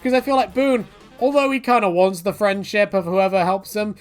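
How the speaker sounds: normal talking voice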